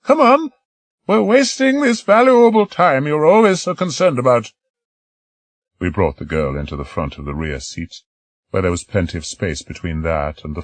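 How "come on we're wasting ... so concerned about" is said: put-on high, quavering old woman's voice; elderly lady. Old lady volunteering to stay behind, high old voice. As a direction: play an eager elderly lady.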